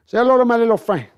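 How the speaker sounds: loud Scarface catchphrase; Scarface impression. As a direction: bark it like Tony Montana. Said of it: "I can do a little bit of Scarface".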